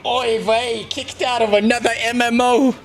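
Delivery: high, slow mock Yiddish voice with laughs; yiddish accent. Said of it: "sit here on stream going".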